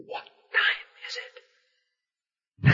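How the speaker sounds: repeated hushed whisper; whispered again. Retold or imitated. Pressing a friend in a quiet chapel, whispering.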